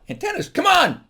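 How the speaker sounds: high, loud comic protest; mock indignation. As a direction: shout in mock disbelief at the crowd. Protests at an unappreciated pun.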